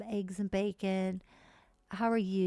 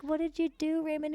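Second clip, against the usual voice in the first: high put-on questioning voice; high mock confrontation; acted character quote; 8 semitones higher than usual. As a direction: imitate her demanding an answer, pitched high. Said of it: "She's like".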